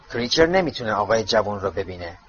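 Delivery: usual speaking voice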